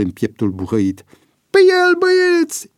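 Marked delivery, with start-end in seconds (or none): 1.5-2.7 very high, strained shout; grunted rallying cry. A narrator's reading. Another prisoner setting the men on Boian, strained cry. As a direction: urge the gang to attack. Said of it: "icni un altul".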